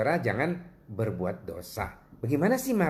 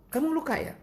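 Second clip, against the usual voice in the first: much higher pitched caring question; concerned question. Retold or imitated. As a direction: ask with high-voiced concern, imitating yourself. Acts out his concerned question.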